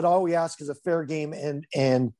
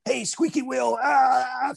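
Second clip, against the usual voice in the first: whiny complainer; 7 semitones higher, much slower than usual. Retold or imitated. Mocking complaining coaches.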